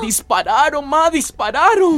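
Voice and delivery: rising to a high, panicked pitch; scared son telling his mother about gunshots; frightened. Acted. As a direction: blurt it out to mom in terror. Son tells mother of shooting.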